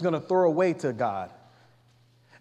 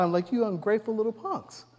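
imagined exasperated God; 5 semitones higher than usual. Retold or imitated. Preacher playing God scolding the grumbling Israelites, exasperated. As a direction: scold them as a fed-up God. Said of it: "I can only imagine if I was God".

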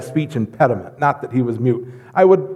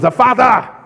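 commanding call; 9 semitones higher, 5 dB louder than usual. Retold or imitated. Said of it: "and he goes".

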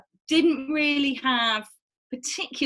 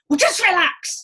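loud higher impatient order; shouting relax; 8 semitones higher, 6 dB louder than usual. Retold or imitated. Doctor shouting at tense patient to relax, loud and higher. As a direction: snap at her to just relax. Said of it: "the more he shouts at me to relax".

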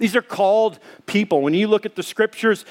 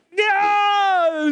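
much higher, shouted yes; shouted yeah. Retold or imitated. Answering worried bystanders, high shouted yes. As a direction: yell back to the bystanders.

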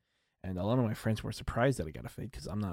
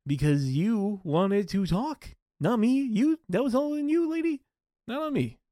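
higher, louder mock-indignant protest; indignant outburst; 13 semitones higher, 8 dB louder than usual. To the chatty barber lady, a loud, high mock-indignant protest. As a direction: throw the blame back at her, loud and high.

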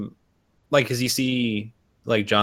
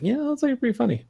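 much higher, amused half-praise; mock approval; 13 semitones higher, 5 dB quieter, faster than usual. Recalling his one favorite Sandler movie, mock amused. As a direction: chirp it like grudging approval. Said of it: "the only movie that I was like".

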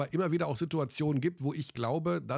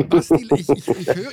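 laughing; 4 semitones higher, 14 dB louder than usual. Real, not acted. Laughing while protesting.

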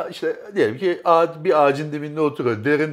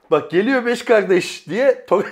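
words breaking into a laugh; laughing at own joke; 6 semitones higher, 3 dB louder, slower than usual. Real, not acted. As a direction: laugh through the punchline.

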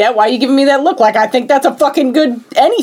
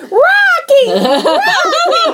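very high called name, laughing; calling Rocky; much slower than usual. Retold or imitated. Adrian calls to Rocky through the crowd, high laughing call. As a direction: shriek his name across the crowd.

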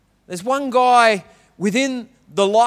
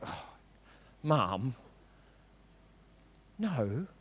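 eye-roll whine; 9 semitones lower, 18 dB quieter, much slower than usual. Retold or imitated. Kid rolling eyes.

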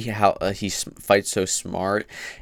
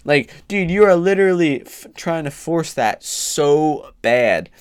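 exasperated; 7 semitones higher, 5 dB louder than usual. Real, not acted. Ranting about the fighter.

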